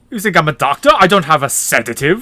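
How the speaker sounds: put-on proper, loud German doctor accent; mock Nazi doctor, loud and proper. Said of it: "He talks like this".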